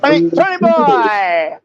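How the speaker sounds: a teasing caricature; mocking put-on voice. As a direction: drag out the name to tease him.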